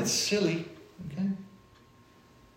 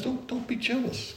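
soft pleading; 10 semitones higher, 7 dB quieter than usual. Acted. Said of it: "Paul says".

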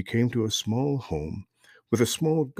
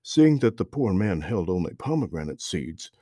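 ordinary delivery

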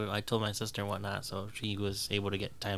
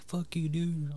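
mildly heated quoted comeback; retold defiance; 7 semitones higher, 4 dB quieter, much slower than usual. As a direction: act out cussing someone off. Retells quarrel with stranger.

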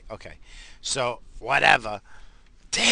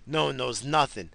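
ordinary delivery

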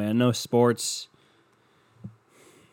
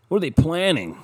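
mock paranoid; 7 semitones higher, 5 dB louder than usual. Mock paranoid repeat, louder.

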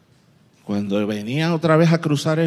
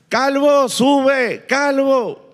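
high, loud taunting yell of calvo; mocking shout; 10 semitones higher, 4 dB louder, much slower than usual. Youths jeering the prophet, loud high mocking shout. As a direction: jeer it up loud like rude kids. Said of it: "le empiezan a gritar".

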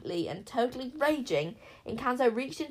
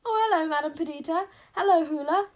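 high, loud guilty greeting; acted high-pitched hello to people below; guilty shout; 8 semitones higher, 8 dB louder than usual. A narrator's reading. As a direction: call down sheepishly, much higher. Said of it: "Wish shouted down so guiltily".

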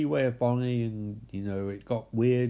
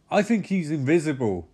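usual speaking voice

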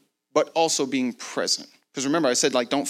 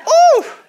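very high, loud "oh" whoop; elated whoop; 11 dB louder than usual. Retold or imitated. Preacher rejoicing at how easy this is, elated whoop. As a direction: let out a jubilant whoop.

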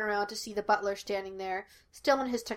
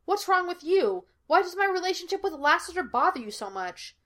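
high, indignant challenge; heated, higher-pitched demand; 9 semitones higher, 3 dB louder than usual. A narrator's reading. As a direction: fire it back, pitch way up. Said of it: "Sean demanded".

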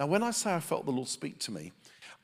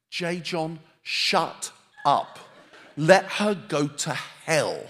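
building from firm to a loud, slow outburst; mock near-shout; 3 semitones higher, 11 dB louder, slower than usual. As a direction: let it burst out, word by word, louder to the end. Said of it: "I came that close to shouting at him".

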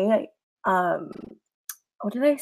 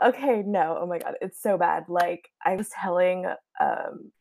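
usual speaking voice

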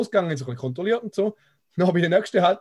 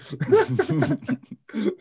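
burst of laughter; 9 semitones higher than usual. Real, not acted. Laughing at the Police badge.

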